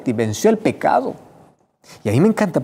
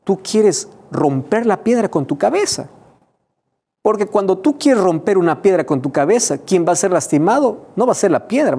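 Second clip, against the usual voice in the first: voz de Jesús; 7 semitones higher, 3 dB louder than usual. Retold or imitated. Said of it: "está diciendo".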